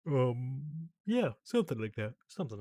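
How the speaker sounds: talking through a yawn. Real, not acted. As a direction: yawn while speaking. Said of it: "I'm just yawning".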